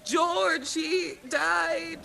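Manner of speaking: high, hammed-up sobbing widow; fake-cry over poor George; mock sobbing widow. Zelda pretending grief to the flower seller, hammed-up sob. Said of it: "And she's like".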